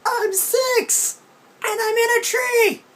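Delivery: put-on very high, loud childish protest; a child stuck in a tree protesting to his aunt; whiny little kid. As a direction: wail it like an outraged six-year-old.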